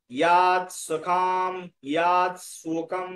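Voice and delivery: slow, drawn-out word-by-word recitation; teacher's slow piece-by-piece model; broken into parts. Shown as a demonstration. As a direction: stretch ইয়াত and the word after it. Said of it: "ভেঙে ভেঙে নিলাম".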